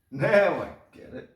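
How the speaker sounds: high, loud exclamation of getting it; excited realization. Real, not acted. Repeats realization, second louder.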